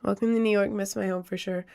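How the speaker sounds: ordinary delivery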